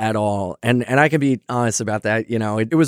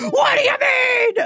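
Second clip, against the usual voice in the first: very high, drawn-out comic squeal; mock panicked shriek; slower than usual. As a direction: stretch it out in an exaggerated high cry. Retold alarm at conflicting opinions.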